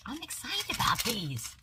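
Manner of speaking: high-pitched, giddy; bubbling over with excitement; excited. Real, not acted. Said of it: "I told you I am off the wall".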